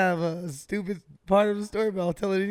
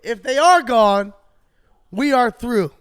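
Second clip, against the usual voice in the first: higher, much louder, slow threat; angry ultimatum; 5 semitones higher, 9 dB louder, slower than usual. Retold or imitated. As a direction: lay down the ultimatum to your girlfriend, furious. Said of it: "I said".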